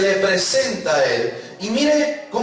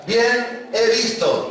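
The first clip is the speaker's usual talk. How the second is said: "Bien he visto" drawn out slowly, BIEN stressed; slow emphatic repeat; 4 semitones higher, much slower than usual. Shown as a demonstration. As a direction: lead the congregation, slowly. ("Bien he visto" lento y marcado)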